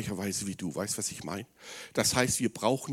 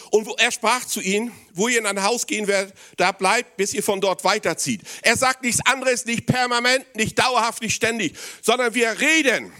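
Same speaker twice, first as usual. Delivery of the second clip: high, loud pulpit proclamation; full-force preaching; 10 semitones higher, 6 dB louder than usual. Acted. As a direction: preach it with fire.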